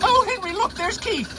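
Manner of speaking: put-on a sweet old woman spotting a friend; Marge. Marge points out Keith.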